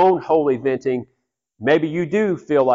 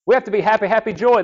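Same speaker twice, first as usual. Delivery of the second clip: higher, sing-song fake happiness; mock forced cheer; 7 semitones higher, faster than usual. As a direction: parody a chirpy churchgoer. Mocking forced church cheer.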